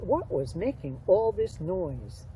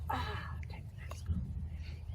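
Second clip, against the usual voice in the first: soft, slow unvoiced whisper; breathy little whisper; 5 semitones higher, 8 dB quieter, slower than usual. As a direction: hush it like a secret to a shy friend. Whispering to a turtle puppet.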